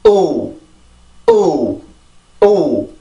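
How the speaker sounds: 오 as one big falling beat; stressed vowel. Shown as a demonstration. Teacher builds the word's rhythm from it, stressed vowel. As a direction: punch 오 alone, letting the pitch drop. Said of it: "요걸 가지고 리듬을 만듭니다".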